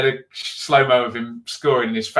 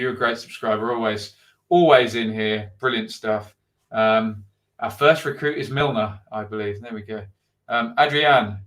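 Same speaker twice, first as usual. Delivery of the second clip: ordinary talk, nothing marked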